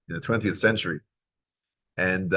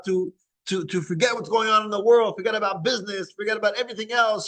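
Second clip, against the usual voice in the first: much higher, ardent sermon voice; fervent preaching; 11 semitones higher than usual. Acted. Preacher urging the congregation to cherish Shabbos, fervent. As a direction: preach the beauty with rising passion.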